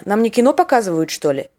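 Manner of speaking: ordinary delivery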